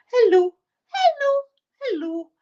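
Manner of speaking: very high cooing hellos; sing-song, high pet-owner greeting; doggy baby talk. Retold or imitated. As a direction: imitate greeting your pet in a squeaky little voice. Greeting dogs over Skype.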